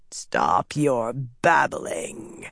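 very slow, low growl; growled command. A narrator's reading. Dark figure silencing a babbling servant, growling. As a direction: growl it out, drawing each word long. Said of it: "the voice growled".

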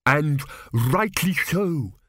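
high, slow haughty priest; pompous priest. A narrator's reading. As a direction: proclaim with smug certainty. Priest of Thrp voiced.

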